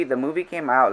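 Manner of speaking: usual speaking voice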